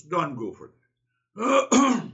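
slow, loud, drawn-out warning; emphatic warning. Acted. Teacher firmly warning students away from rituals, slow and loud. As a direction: stretch out each word to warn them off.

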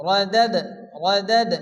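ردد with a light bounce on the final dal; qalqalah on dal. Shown as a demonstration. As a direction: tap the د in ردد with a light echo. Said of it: "দাল কলকল অক্ষর হালকা ধাক্কা দিয়ে".

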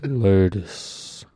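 deep soul-crooner voice. Retold or imitated. Imitating buddy greeting the women, deep smooth croon. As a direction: sink the word very low and let it hang.

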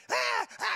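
high, loud mock screams; hammed-up kid screaming; imitated frantic kids. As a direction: shriek like children running wild. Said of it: "they were just like".

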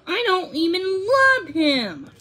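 high-pitched outburst of refusal; emphatic protest. A narrator's reading. Bride refuses at the altar.

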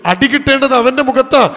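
normal talking voice

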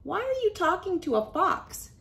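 high, fast squeaky squirrel call; squirrel cries out. Acted. Squirrel calls to the worm, high fast alarmed cry. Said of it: "cried a squirrel".

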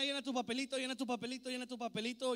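ordinary talk, nothing marked

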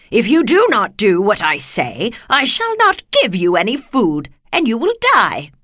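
loud witch threat, climbing high; threatening wicked witch. A narrator's reading. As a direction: menace the lion as the wicked witch.

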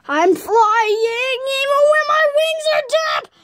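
high, drawn-out wail climbing in pitch; long rising shriek; flying cry. Acted. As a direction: wail it out as you soar, ending in a scream. Play-acting flying, then falling.

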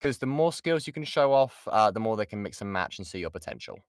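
ordinary talk, nothing marked

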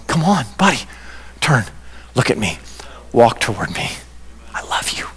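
soft, low, with gentle pauses; tender coaxing. Acted. Jesus standing over there calling a sinner, tenderly. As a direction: coax them closer, fading almost to a whisper.

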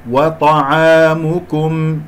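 وطعامكم recited slowly, final M clearly sounded; clear meem. Shown as a demonstration. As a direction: draw the aa, close clearly on M in وطعامكم. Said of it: "ميم مظهرة". (ترتيل «وطعامكم» بميم مظهرة)

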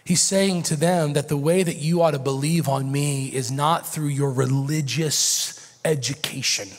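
ordinary talk, nothing marked

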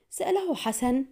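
plain reading voice

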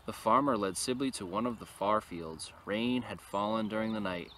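ordinary delivery

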